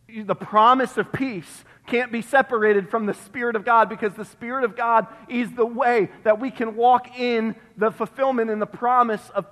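usual speaking voice

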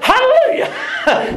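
high, slow, laughing hallelujah; joyful hallelujah. Retold or imitated. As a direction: stretch it out with a laugh. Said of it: "I'm like".

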